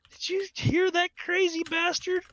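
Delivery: high, lively mock-astonished question; half-laughing at the madness; a touch of amazed disbelief. Speaker asking the manager about the old man, amazed.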